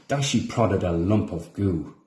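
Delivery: ordinary delivery, nothing marked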